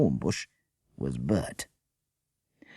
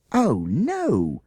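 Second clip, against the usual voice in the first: higher, louder cry of horror; horrified cry; 9 semitones higher, 4 dB louder than usual. Acted. Children seeing the holdall break, crying in horror. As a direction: gasp it as the bag falls open. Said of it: "cried the children in horror".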